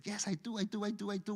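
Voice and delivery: a hint of babyish eagerness; excited, like a baby. A narrator's reading. Little snake answering the other snake, mildly excited. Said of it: "with such excitement, you know, like a little baby".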